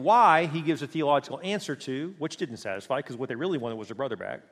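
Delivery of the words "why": punched, louder accusing question; arms-crossed demand. Retold or imitated. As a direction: throw it out as a challenge. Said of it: "with their arms crossed saying".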